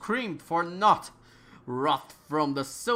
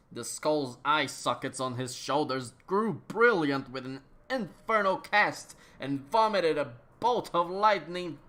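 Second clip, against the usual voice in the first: ordinary delivery, nothing marked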